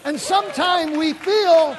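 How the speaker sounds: loud, high, slowed preaching; full-force preaching. Acted. Preacher proclaiming God restores, full-force preaching. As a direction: declare it with conviction. Preacher voicing God's answer.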